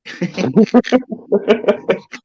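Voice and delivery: high giggle. Real, not acted.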